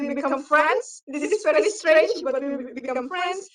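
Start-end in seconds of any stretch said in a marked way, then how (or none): none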